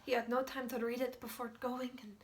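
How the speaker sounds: loud, stammering urgency; frantic and loud, tripping over repeated words; agitated, panicked recounting. Acted. Agitated account of frantic rush.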